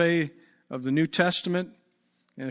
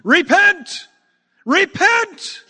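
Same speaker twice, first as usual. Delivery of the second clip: high, full-force shouted cry; shouting preacher; 10 dB louder, much slower than usual. Acted. John in camel hair calls crowds to repent, shouting. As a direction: cry out at the top of your lungs. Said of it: "shouting at the top of his lungs".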